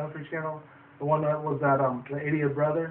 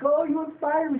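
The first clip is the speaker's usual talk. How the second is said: much higher, mock little girl; hammed-up little girl gushing; high imitation of an awed little girl; 12 semitones higher than usual. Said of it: "this one little girl's like".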